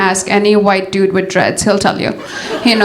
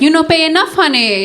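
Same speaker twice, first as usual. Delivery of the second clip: a hint of a haggling streetwalker, pitched up; mock broken English; 6 semitones higher than usual. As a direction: tease him in clumsy pidgin English. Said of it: "be like".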